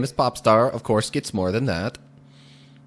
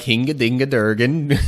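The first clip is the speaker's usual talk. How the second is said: louder, hammed-up fake Scandinavian gibberish; mock Swedish chef gibberish; 2 semitones higher, 4 dB louder than usual. Gamer jokingly breaks into mock Scandinavian gibberish, louder and hammed-up. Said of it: "I'm really tempted right now to just go all".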